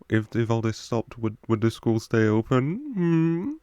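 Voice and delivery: lightly voiced cheeky schoolboy asking; mischievous Tom Riddle. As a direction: play him a touch mischievous. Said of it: "I'm doing him in that voice".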